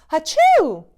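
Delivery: big loud hachoo; a loud fake sneeze. Father Christmas sneezing.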